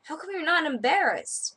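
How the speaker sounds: quoted client. Retold or imitated. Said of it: "And he said".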